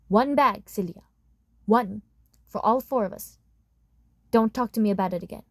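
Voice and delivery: jab each word like a finger in her face; stern angry father. Acted. Dad lays down the law angrily.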